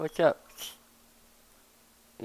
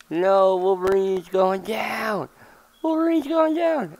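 mock panic; 6 semitones higher than usual. Character losing in the fight.

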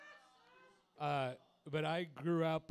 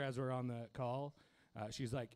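normal talking voice

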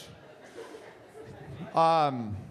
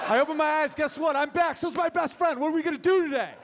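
much higher, bursting with joy; thrilled dog; 8 semitones higher than usual. Pretend. Dog waking its owner in the morning, bursting with joy. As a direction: wake up amazed to be alive.

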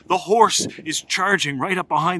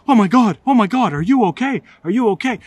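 alarmed cry; 6 semitones higher, 5 dB louder than usual. Retold or imitated. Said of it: "Dad goes".

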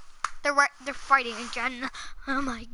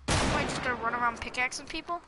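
ordinary talk

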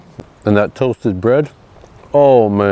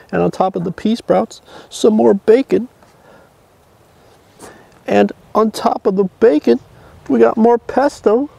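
building excitement; 12 semitones higher than usual. Real, not acted. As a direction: hype each layer more than the last. Stacking sandwich, voice climbs high.

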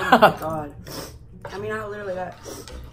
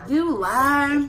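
indignant; 7 semitones higher, 8 dB louder than usual. Real, not acted. Heated argument over the rules.

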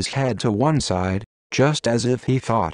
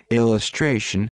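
plain reading voice

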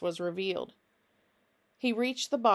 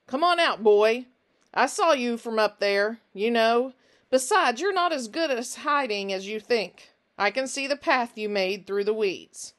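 calling loudly toward the garden, not a friendly tone; loud, projecting voice; 3 semitones higher, 5 dB louder than usual. Acted. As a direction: project the voice across the field, cold and confident. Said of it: "He spoke loudly, projecting his voice".

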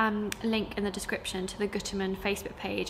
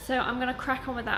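ordinary delivery

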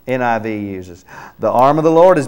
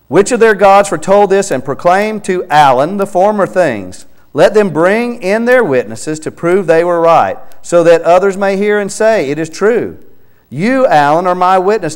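raised, louder reading of the verse; fervent scripture reading; 7 semitones higher, 6 dB louder than usual. Acted. Preacher reading Isaiah aloud to Alan, fervent and louder. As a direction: proclaim the verse over the listener.

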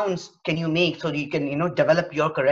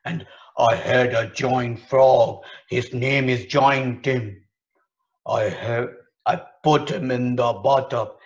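giant voice; 6 semitones lower, 4 dB louder than usual. Shown as a demonstration. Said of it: "make a voice of a giant".

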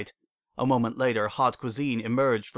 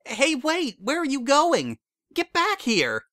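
much higher, loud calls after someone running off; calling after her; 4 dB louder, slower than usual. A narrator's reading. Carrot Top yells after Bon Bon galloping away, loud. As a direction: shout to stop her leaving.

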